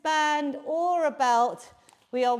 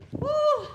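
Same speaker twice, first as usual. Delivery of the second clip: excited whoop; 12 semitones higher than usual. Real, not acted. Cheering at workout's end.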